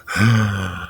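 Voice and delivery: sharp audible gasp in; demonstrated breath. Shown as a demonstration. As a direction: gasp the breath in to show the letter. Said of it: "The fifth letter is the".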